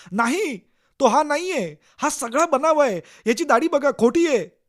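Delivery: high, loud protest of innocence; desperate shout. Acted. Accused man shouting to the police that it is a setup, desperate. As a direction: yell it at the police, unable to hold back. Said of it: "न राहून तुम्ही ओरडता".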